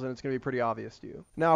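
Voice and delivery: normal talking voice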